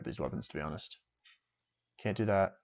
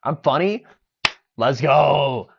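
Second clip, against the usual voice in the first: excited; 7 semitones higher, 12 dB louder, slower than usual. Real, not acted. Delighted at being called funny.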